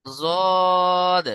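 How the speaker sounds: long, sustained জদ; tongue-edge letter demo. Shown as a demonstration. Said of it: "জিভের কিনারা তার বরাবর".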